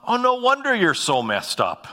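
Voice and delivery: high-pitched mock realization, falling at the end; acted inner thought. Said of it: "here's what will go through my mind".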